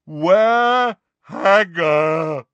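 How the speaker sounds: slow loud giant demand; slow booming giant. Acted. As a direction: drag it out like a huge giant.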